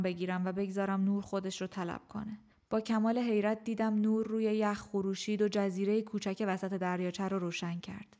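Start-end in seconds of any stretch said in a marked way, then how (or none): none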